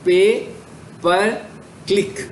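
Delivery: pay, per and click each said alone with a pause between; word by word. Shown as a demonstration. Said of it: "PPC stands for".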